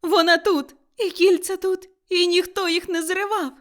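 high, loud bursts of relief; agitated, elated shouting; excited cries. A narrator's reading. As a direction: exclaim it in breathless joy. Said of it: "закричав Скрудж".

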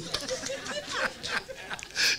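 long, high breath of laughter; real laughter. Real, not acted. Preacher amused at his own point, soft high laugh. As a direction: chuckle on softly, high up.

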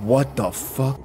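ordinary talk

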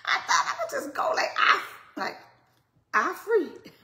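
loud, high laughing through the words; laughing loudly. Real, not acted. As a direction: burst out laughing at yourself, fast. Laughing at her own eye surgery joke.

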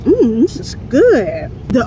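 ordinary delivery

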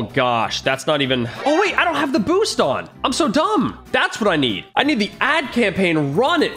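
ordinary talk, nothing marked

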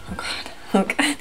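breathy, nervous murmur. Real, not acted. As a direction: fret under your breath as the clock starts. Nervous as the timer starts.

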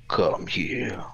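menacing slow whisper to the dog; sly whispered invitation. Acted. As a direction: lure it closer in a hush. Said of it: "unvoiced whisper".

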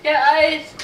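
urgent call. Real, not acted. Burning mouth from hot wings.

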